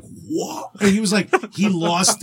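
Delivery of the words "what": stunned what. Retold or imitated.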